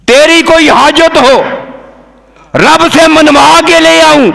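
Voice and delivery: high, ringing pulpit delivery; declaimed offer. Acted. Preacher voicing the Prophet's offer to Gabriel, declaiming. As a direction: proclaim the offer on a high sustained pitch.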